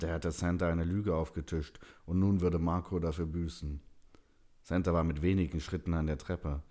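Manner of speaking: usual speaking voice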